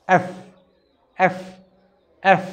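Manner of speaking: "এফ" with lip under the teeth, air pushed out; letter F demo. Shown as a demonstration. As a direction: breathe out through "এফ". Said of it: "এভাবে মুখ দিয়ে বাতাস বের হবে". (দাঁতে ঠোঁট রেখে "এফ")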